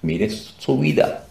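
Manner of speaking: ordinary delivery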